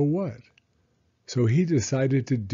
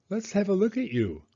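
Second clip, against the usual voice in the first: high, cheerful bear voice; bright little bear; 8 semitones higher, faster than usual. Acted. Pooh offers to look Eeyore over, high and friendly. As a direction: lift it up like a kindly little bear.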